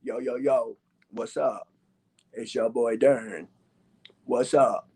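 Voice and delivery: very low, slow, understated channel intro; mock ninja YouTuber. YouTuber mocking a masked rival's channel greeting, deep ninja persona. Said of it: "I come on the YouTube channel like this".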